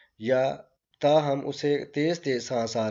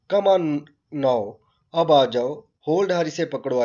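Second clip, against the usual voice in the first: loud, raised call to hurry; urgent call; 5 dB louder than usual. Acted. Doctor urges the parents to hold the girl, loud urgent call.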